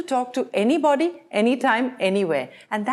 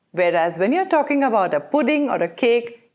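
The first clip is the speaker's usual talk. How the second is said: normal talking voice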